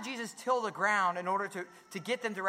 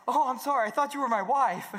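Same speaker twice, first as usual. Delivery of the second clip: flustered apology; 6 semitones higher, 6 dB louder than usual. Retold or imitated.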